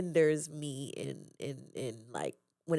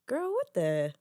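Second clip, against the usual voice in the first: higher, louder mock scolding; scolding past self; 9 semitones higher, 4 dB louder than usual. Looking at old pictures of herself, playful scolding. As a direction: chide your younger self, playful. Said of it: "I'm like".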